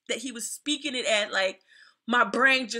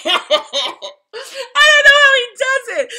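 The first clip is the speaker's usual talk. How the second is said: much higher, louder and slow, laughing through it; real laughter, pitched up and loud; laughing disbelief; 12 semitones higher, 12 dB louder, much slower than usual. Real, not acted. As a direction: laugh helplessly as you say it.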